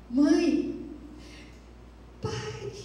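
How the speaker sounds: high, sudden call to mom and dad; startled call. Acted. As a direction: cry out in shock at the find. Finds baby in the bag. (chamado assustado e agudo)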